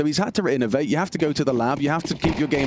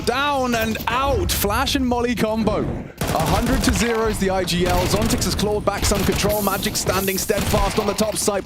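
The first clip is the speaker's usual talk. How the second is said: excited play-by-play; 6 semitones higher than usual. Real, not acted. Caster calling a round live.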